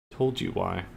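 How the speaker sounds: soft, muttered scorn; quiet talking down. Shown as a demonstration. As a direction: drop to a quiet put-down. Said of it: "it's talking down to her".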